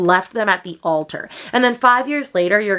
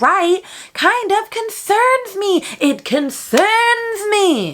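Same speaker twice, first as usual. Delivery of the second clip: mock concern; 11 semitones higher, much slower than usual. Mock concern at author's choice.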